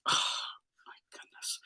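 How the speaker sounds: breathy whispered aside; hushed mock-shocked whisper; whispered dismay. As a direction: whisper it under your breath, appalled. Retelling dismay at her kicking.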